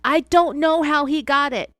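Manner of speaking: high, loud shout back; wife yells her denial at the agent; yelling back. Retold or imitated. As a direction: shout it back at him defensively. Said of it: "she yelled back".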